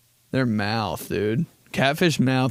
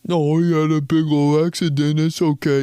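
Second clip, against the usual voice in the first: loud, higher cooing excuse; doting owner; 4 semitones higher, 4 dB louder than usual. Retold or imitated. Dog owner excusing the mess to the office, louder cooing voice. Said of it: "Just shitting all over".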